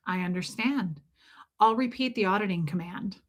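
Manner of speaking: emotionless, dead-behind-the-eyes auditor reply; lifeless auditor. Acted. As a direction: acknowledge it with no feeling at all, like a trained soldier. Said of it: "lifeless, dead behind the eyes soldiers".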